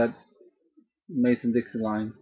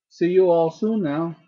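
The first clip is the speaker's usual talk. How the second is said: attempted Southern accent, higher and louder; Southern US send-off, raised pitch and volume; 6 semitones higher, 5 dB louder than usual. Shown as a demonstration. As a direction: call it out in an amateur Southern accent. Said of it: "if that was a good southern accent".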